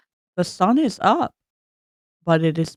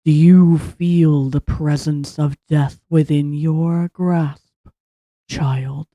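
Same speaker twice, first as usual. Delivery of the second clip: slow, louder booming god voice; 3 semitones lower, 9 dB louder, much slower than usual. Acted. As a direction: boom it out like a dark god. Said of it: "you hear a booming voice echo around you".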